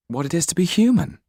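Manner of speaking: eager youthful answer; young Jan. Acted. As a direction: blurt out his passion. Narrator voices young Jan.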